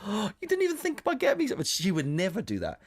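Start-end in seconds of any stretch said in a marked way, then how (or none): 0.1-1.6 very high, rushed wounded voice; mock offended partner. Partner left without a treat, mock-offended protest. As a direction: imitate a hurt partner in high, quick protest.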